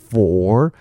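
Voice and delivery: "for" said long with full "or" vowel; slow, full "for"; dictionary full form. Shown as a demonstration. Teacher reads the dictionary sound, full "for". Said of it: "它确实会标for".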